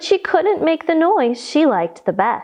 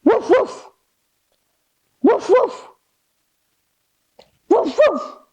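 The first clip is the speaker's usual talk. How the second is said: big dog woofs; 8 semitones higher than usual. Shown as a demonstration. Teacher leads children in dog pose, barking woof. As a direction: bark like a playful dog. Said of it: "give me a big".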